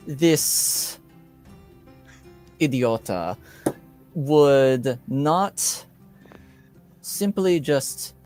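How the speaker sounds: put-on measured accented nobleman, halting with pauses; lightly put-on aggrieved lord; Lord Vargas in character. Vargas explaining himself to the girl, measured aggrieved lord.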